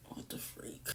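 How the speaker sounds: breathless whisper of disbelief. Retold or imitated. Embarrassed among diners watching her boyfriend, whispering. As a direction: whisper it under your breath, mortified.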